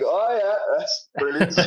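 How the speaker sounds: high-pitched, lightly laughing fake approval; mock enthusiasm. Comedian to someone copying their Irish accent, mock enthusiasm. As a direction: humour them with a high, put-on reaction. Feigned reply to accent mimicry.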